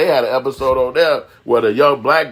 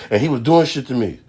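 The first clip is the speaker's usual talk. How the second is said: ordinary delivery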